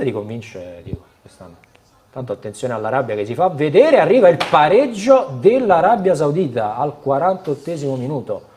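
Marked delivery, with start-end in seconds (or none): 2.1-8.4 excited live play-by-play, loud and high; breathless italian goal commentary. Real, not acted. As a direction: call the equaliser like a live goal. Live goal call during broadcast. (telecronaca concitata del gol)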